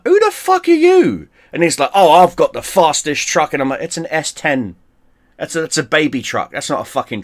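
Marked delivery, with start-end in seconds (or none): 0.0-1.3 loud, very high mock outrage; indignant disbelief. Driver retelling his reply to unknown challengers, indignant. 1.9-3.5 lightly voiced bragging rival, higher and louder; mock boaster. Driver mimicking a rival truck owner, mildly mocking boast.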